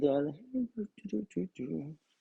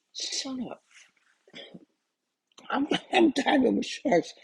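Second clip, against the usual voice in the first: much higher, laughing disbelief; shocked excitement; 12 semitones higher, 3 dB louder than usual. Retold or imitated. Diver seeing huge sharks, high and laughing in disbelief. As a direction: squeal it in laughing amazement.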